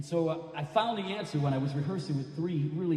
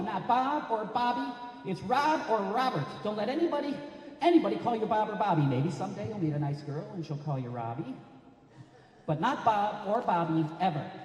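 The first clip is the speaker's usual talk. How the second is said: higher, insistent lecturing mom; mother drilling it in; 7 semitones higher than usual. Retold or imitated. Mom drilling the name into her son, insistent. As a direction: lay down the rule like a strict mother. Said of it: "ask my mother, who drilled into me".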